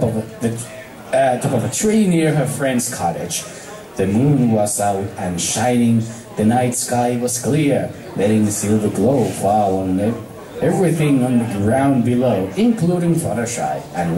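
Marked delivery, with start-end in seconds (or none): none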